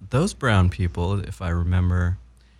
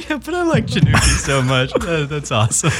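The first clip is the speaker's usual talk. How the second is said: higher, louder talk through laughter; laughing; 8 semitones higher, 3 dB louder than usual. Real, not acted. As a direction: still cracking up at the silly word. Still laughing at the silly name.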